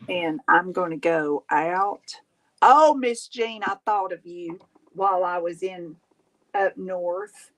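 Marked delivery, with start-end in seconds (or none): none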